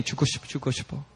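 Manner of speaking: soft, fast imitated chant; repetitive muttering. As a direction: repeat it under your breath. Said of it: "She just started repeating".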